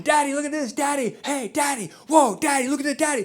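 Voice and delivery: put-on a little boy pointing at everything; excited kid. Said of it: "he's, like, screaming".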